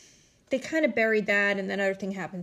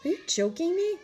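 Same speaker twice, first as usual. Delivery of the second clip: high, mocking voice, as if stunned by a Chinese menu; mocking clueless women; 6 semitones higher than usual. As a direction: play them as clueless, wide-eyed and mocking. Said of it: "they're acting like this Chinese restaurant is like".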